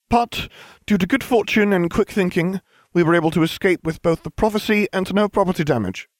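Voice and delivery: high girlish voice, lightly voiced; a young witch, curious; Hermione voice. A narrator's reading.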